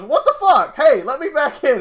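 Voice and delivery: high, loud indignant shout; shocked yell. Retold or imitated. Shut-out officer yelling through the door, high and loud. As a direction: bang on the door to get back in. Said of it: "He goes".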